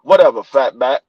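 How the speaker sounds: put-on low, dismissive kid's retort; sassy grandson. Grandpa imitating grandson calling him fat back, low sass. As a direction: toss the insult off lazily.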